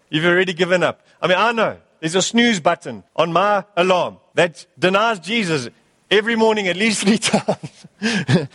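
normal talking voice

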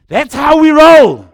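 big, high, drawn-out whoop of a boast; triumphant yell. Retold or imitated. Preacher retelling his U-turn on the highway, triumphant yell. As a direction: shout it slow and loud, far above talking pitch.

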